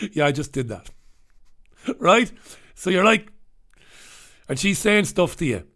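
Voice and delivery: ordinary delivery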